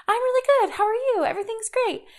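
imitating herself presenting happy while really sad; fake cheerful. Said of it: "that voice, that tone, that speed when I'm presenting happy".

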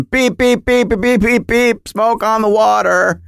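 sung beeps as phone tones; imitated dial tones. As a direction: beep out the dialing like music.

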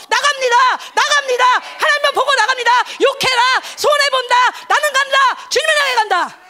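loud, high, fervent shouts; preacher so fired up he shouts his resolve; excited shouting. Real, not acted. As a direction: charge ahead, shouting it out. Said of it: "흥분하다 보니까".

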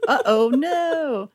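high, slow uh-oh; singsong fake oops; mock dismay. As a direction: draw it out in pretend worry. Mocking Cyclops's fake accident.

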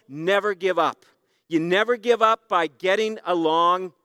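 normal talking voice

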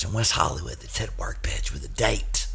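whispered confession. Acted. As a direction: hush it like a secret. Whispered aside.

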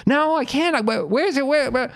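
very high, hammed-up panicky wail; frantic kid. Boy on dad's shoulders searches, frantic. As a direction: imitate a boy desperately searching the crowd.

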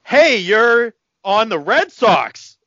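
loud, high, drawn-out mock fan yell; gawking fan. Starstruck fan accosting a Red Sox player, loud mock yell. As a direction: blurt it out slowly like a starstruck dope.

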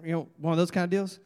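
ordinary delivery

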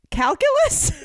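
high, loud disbelieving calculus?; incredulous. Real, not acted. Host baffled by a calculus video project, incredulous.

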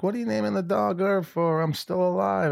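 high, mock-indignant old man; grandfather's voice. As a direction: squeak it out like an offended granddad. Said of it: "And he's like".

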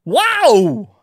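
high, loud, stretched amazement; astonished, drawn-out exclamation; Christopher Walken. Acted. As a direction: bend one word into several syllables. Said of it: "Christopher Walken sees an amazing magic trick".

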